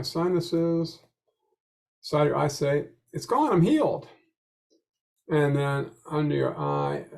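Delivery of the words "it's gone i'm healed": high, eager declaration of being healed; over-the-top hopeful claim; bright affirmation. Shown as a demonstration. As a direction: say it like you truly believe you're cured. Positive affirmation during tapping demo.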